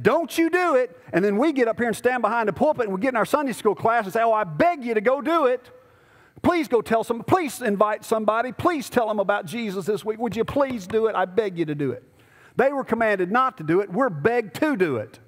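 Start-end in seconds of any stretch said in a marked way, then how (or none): 0.0-1.0 mock command. Voices authorities forbidding preaching. 4.2-12.0 mock pleading. Mocks pastor begging the congregation.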